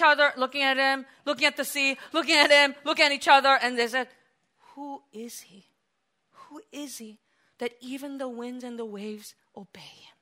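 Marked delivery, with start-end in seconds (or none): 4.7-10.2 hushed, breathless whisper of awe; awed whisper. Retold or imitated. Disciples staring at each other after the storm, awed whisper. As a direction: whisper in wonder.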